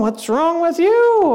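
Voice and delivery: high, drawn-out mock accusation; acted indignant comeback; accusing back. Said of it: "I'm looking at you going".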